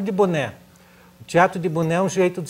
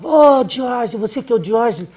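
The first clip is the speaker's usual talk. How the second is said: king calling out; 6 semitones higher, 5 dB louder than usual. Acted. King calls out to Diógenes.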